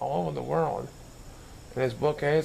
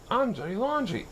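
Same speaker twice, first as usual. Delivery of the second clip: bright, high, playful chirp; a boy chirping his costume name; 9 semitones higher than usual. A narrator's reading. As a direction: chirp it out cheerfully. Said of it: "he chirped".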